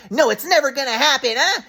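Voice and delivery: high, dismissive mom voice; mocking mom. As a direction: brush him off like a cold mother. Mom's refusal acted out.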